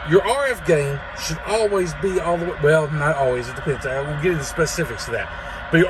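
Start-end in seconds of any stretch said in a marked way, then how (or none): none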